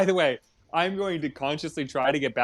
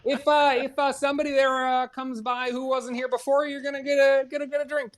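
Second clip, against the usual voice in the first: much higher, mock-Canadian rule reading; funny Canadian accent; 9 semitones higher than usual. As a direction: push the fake Canadian accent way up high. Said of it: "talking a funny accent".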